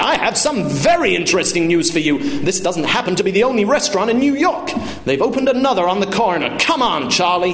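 high, blustering retort; indignant father. Acted. Father tells off waiter and calls son away, indignant.

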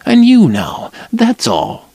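a hint of a nervous young woman; Lila's voice.

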